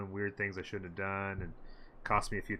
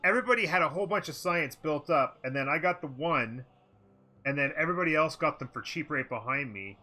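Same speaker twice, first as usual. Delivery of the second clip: annoyed rant; 6 semitones higher, 4 dB louder than usual. Real, not acted. Complaining about rivals.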